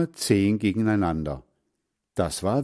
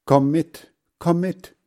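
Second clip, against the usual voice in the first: high, raised urgent call; 8 semitones higher, 5 dB louder than usual. A narrator's reading. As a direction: call someone to come along. Boys calling as storm starts. (hoch und dringend rufen)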